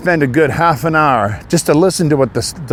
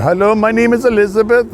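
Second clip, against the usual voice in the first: higher, sing-song fake-friendly phone voice; lightly voiced scammer woman introducing herself; mock call-center greeting; 8 semitones higher than usual. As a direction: imitate a scammer faking a sweet hello. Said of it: "You know, a female on there".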